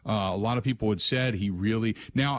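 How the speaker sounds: ordinary delivery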